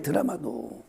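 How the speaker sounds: 드라마도 repeated softly, dropping away; soft trailing echo of 드라마도. Real, not acted. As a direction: say 드라마도 again under your breath. Quiet echo of the word.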